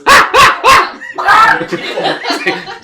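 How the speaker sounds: very high, loud hen squawking; panicked chicken noises. Acted.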